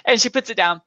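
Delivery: ordinary talk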